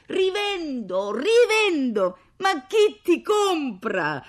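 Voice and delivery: very high, slow, drawn-out holler; thundering shout. A narrator's reading. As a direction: bellow it across a courtyard. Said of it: "la sua voce tuonava". (grido tonante, prolungato)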